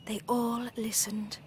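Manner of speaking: hushed whisper. A narrator's reading. Storyteller to children, whispering that they listened. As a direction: breathe it out unvoiced.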